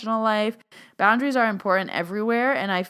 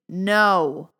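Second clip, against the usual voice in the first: loud, drawn-out no; firm no; 5 dB louder than usual. Shown as a demonstration. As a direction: lead the group in a decisive refusal. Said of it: "Everyone say it with me".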